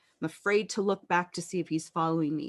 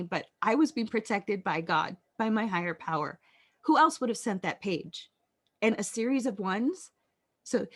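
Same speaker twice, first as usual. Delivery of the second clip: ordinary talk, nothing marked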